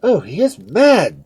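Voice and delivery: high, loud, slow exclamation; alarmed cry. A narrator's reading. Spectators around the screaming Duke, alarmed cry. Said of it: "cried all the spectators".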